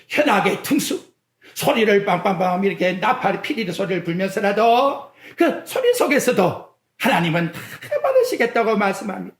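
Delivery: ordinary talk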